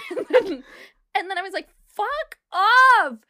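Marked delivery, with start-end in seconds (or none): 1.9-3.2 high, loud re-enacted shout; explosive shout of outrage; exasperated yell. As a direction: yell it out in fed-up disbelief. Own reaction re-enacted at full volume.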